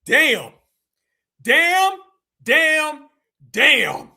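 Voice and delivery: four loud, punched damns, rising on the second; loud stunned damns. Real, not acted. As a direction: hit each damn hard, in disbelief. Reacting to the shocking clip.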